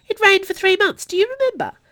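high, loud, animated reminiscing; chatty. A narrator's reading. Delphine reminiscing to her friend about rain, chatty. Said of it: "Delphine said chattily".

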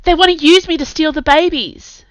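high, loud desperate yell. A narrator's reading. Finn shouting a warning while being crushed, yelling. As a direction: yell it out over the struggle. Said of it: "she yelled".

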